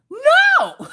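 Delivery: sudden high loud no; high panicked shout. Real, not acted. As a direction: yell it in a panic. Scared of needles, refusing.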